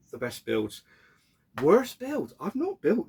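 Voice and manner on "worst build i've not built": mock outrage. Real, not acted. Reading question, big jump.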